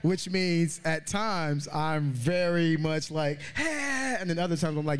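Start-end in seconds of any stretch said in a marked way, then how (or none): 3.6-4.2 high, eager party greeting; outgoing extrovert. Acted. Introverted extrovert showing the audience the outgoing side, high and eager. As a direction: pitch it up like greeting the whole room. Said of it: "I'm a very introverted extrovert".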